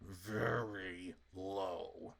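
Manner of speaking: deep, slow mock-ominous drag; ominous low voice. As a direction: drop into a deep doom voice for the lows. Mock-dramatic about the low points.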